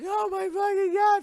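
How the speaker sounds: very high, loud cry; hammed-up horrified outburst; shocked scream. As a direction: shriek it in exaggerated shock. Voices shocked detectives.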